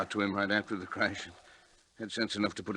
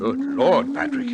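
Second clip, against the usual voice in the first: high, loud gasp of alarm; shocked outcry; 9 dB louder, slower than usual. Acted. Colleague reacts as the brain wakes up, shocked outcry. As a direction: cry out, stunned.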